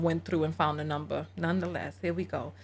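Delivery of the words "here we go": usual speaking voice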